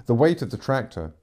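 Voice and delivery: ordinary delivery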